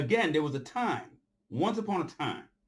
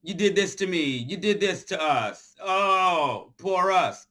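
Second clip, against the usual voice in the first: higher, louder whiny mockery; mock whining; 5 semitones higher, 4 dB louder, slower than usual. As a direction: whine it out like a self-pitying victim. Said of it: "You gonna go whine to him?".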